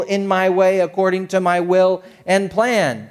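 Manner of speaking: fervent preaching. Acted. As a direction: preach it with rising conviction. Preaching at full force on Spirit.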